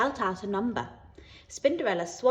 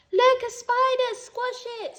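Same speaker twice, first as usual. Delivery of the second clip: a high, slow shriek of alarm; screamed; 5 dB louder, slower than usual. A narrator's reading. A child spotting a spider in class, shrieking high. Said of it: "screamed someone".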